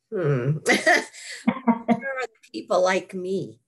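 slow, laughing, wry question; laughing wonder. Retold or imitated. At the meetings wondering about her kind, slow and laughing. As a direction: chuckle while asking, drawn out. Recalling near-death study meetings.

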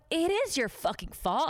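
high, indignant retold retort; heated replay of a quarrel; arguing back. Retold argument with the boss.

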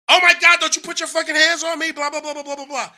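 high, loud shrieking outburst, then trailing into blah blah; mock hysterical yell. Partner shrieking at him not to touch her, hysterical yell. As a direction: imitate her losing it, screaming high. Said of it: "She lost it".

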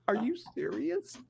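very high, soft, drawn-out mimicry; nicest voice. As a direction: squeak it meekly, imitating the stunned man. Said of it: "in the nicest voice".

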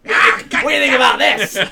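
can barely get it out for laughing; laughing out the question. Real, not acted. Laughing while telling story.

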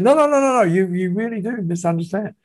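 high-pitched rapid protest, easing down; insistent correction. Retold or imitated. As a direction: imitate someone waving away a misunderstanding. Retold objection to his misunderstanding.

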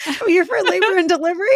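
laughing high through the words; giggling while announcing herself; high laughing announcement. Retold or imitated. Retold arrival at hospital, laughing.

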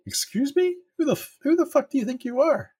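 very high, offended shriek of disbelief; indignant high squeak. Retold or imitated. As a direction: squeak it out, mock outraged. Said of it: "And I was like".

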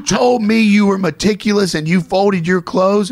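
high, slow mock accusation; mock outrage. Mock scolding of mom in photo.

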